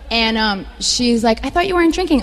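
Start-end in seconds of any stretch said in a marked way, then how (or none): 1.4-2.2 surprised friend. Retold or imitated. Friend questions her ordering beer.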